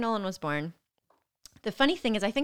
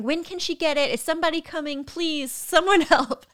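high mock-desperate voice, building louder; panicked pleading; 9 semitones higher, 4 dB louder than usual. Poor Derek asking staff for the epidural, panicked pleading. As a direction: imitate a frantic partner begging, dragging out the last words.

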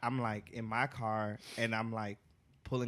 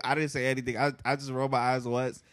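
ordinary delivery

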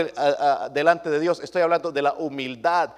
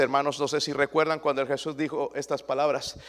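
normal talking voice

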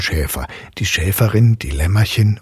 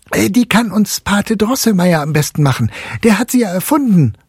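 put-on high, loud, fast boy's cry; calling out; 13 semitones higher, 4 dB louder than usual. A narrator's reading. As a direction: blurt the answer out eagerly. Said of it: "rief Fritz".

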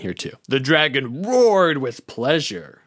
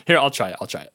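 ordinary talk

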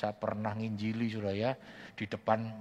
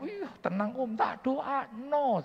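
much higher, slow, calming promise; soothing reassurance; 3 dB louder, slower than usual. Retold or imitated. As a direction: settle him down gently, stretching the words. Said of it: "saya bilang".

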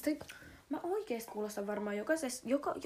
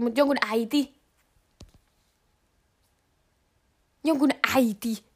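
loud appalled disbelief; stunned, it's someone's mom; shocked; 12 dB louder than usual. Real, not acted. Shocked repetition. (järkyttyneenä ja kovaa)

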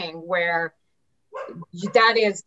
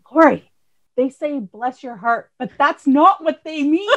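quoting mom, higher and louder with a laugh; a light take on mom explaining Southern talk; imitating mom; 6 semitones higher, 6 dB louder than usual. Mom tells her daughter what bless your heart means, lightly mimicked. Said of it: "my mom was talking about".

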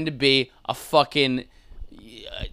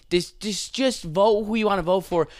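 ordinary delivery

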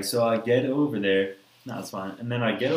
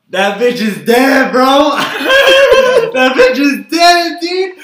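much louder and higher, slow and drawn out; exasperated outburst; 17 dB louder, slower than usual. Retold or imitated. Telling friends his truck died after smoking, a loud drawn-out wail. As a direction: wail it like disaster struck. Car won't start, exclaiming.

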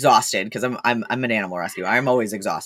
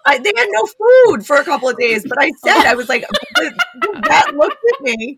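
laughing; 12 semitones higher, 4 dB louder than usual. Real, not acted. Laughing while telling.

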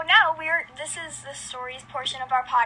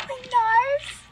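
shocked; 9 semitones higher, slower than usual. Real, not acted. Shocked reaction.

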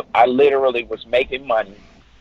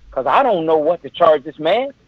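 normal talking voice

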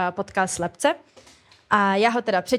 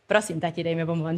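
lower, softer polite plea; 5 semitones lower, 3 dB quieter, faster than usual. Retold or imitated. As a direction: ask nicely like a sweet child. (prosebné dítě, tišší a nižší hlas)

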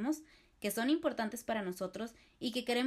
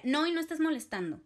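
higher, louder curt refusal; aggressive refusal; 6 semitones higher, 5 dB louder than usual. Shown as a demonstration. To someone asking to borrow, a loud curt no. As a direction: slam the door on the request. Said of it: "Una respuesta agresiva sería".

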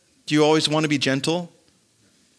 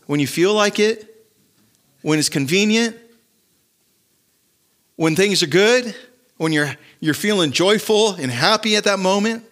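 rising rhetorical challenge; 5 semitones higher than usual. Real, not acted. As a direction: press the crowd harder with each question. Rhetorical questions about rejoicing.

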